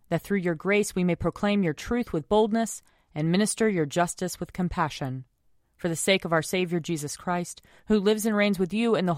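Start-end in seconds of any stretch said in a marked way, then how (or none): none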